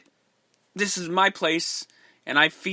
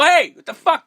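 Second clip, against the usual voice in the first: loud, higher mock yell of the cornerman; mock shout; 11 semitones higher, 10 dB louder than usual. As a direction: bark it like the angry cornerman, much louder. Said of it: "you can hear the cornerman go".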